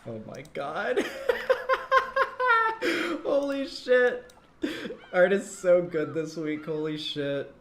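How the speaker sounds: laughing amazed. Real, not acted. Excited laugh while seeing the art.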